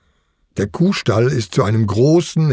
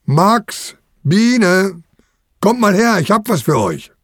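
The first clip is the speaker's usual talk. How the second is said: put-on higher-pitched call to the children; summoning the kids in a raised, singing pitch; 8 semitones higher than usual. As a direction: call them over from upstairs. Uncle calls children upstairs.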